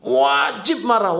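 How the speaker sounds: ordinary talk, nothing marked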